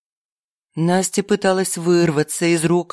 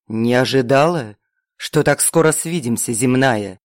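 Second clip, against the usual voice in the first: demonic voice; 4 semitones lower than usual. A narrator's reading. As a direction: boom it like a fire demon. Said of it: "голос прозвучал демонически громко".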